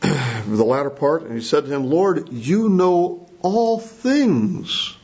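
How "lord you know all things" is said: slow, stretched "all things"; heated emphasis. Retold or imitated. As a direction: draw out "all things" as a weighty appeal. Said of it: "turn up the heat a bit as far as emphasis".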